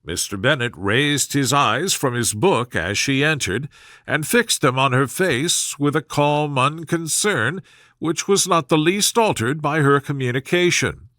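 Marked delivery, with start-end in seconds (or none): none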